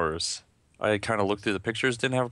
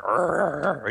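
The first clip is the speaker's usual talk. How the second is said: high mock animal growl; playful, drawn-out snarl; imitated growl; 6 semitones higher, 4 dB louder than usual. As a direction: growl like biting into prey. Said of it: "I was like".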